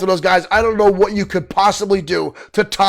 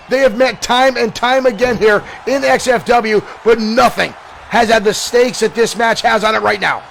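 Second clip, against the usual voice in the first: ordinary talk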